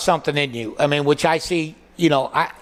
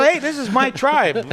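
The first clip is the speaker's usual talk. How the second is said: higher, drawn-out quote; imitated glad recognition; 9 semitones higher, slower than usual. Comic on finding like-minded people, imitated glad recognition. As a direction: hail your own kind, pitched up. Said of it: "you go".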